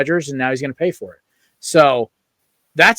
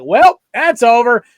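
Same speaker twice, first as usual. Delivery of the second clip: resigned groan; 8 semitones higher, 7 dB louder, slower than usual. Retold or imitated. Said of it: "I was just like".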